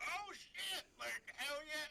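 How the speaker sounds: high, excited exclamation; acted thrilled reaction. Game master voicing a fire caster landing a shot, thrilled. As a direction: pitch it up, amazed and delighted.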